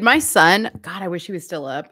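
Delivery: ordinary delivery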